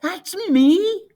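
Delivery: plain reading voice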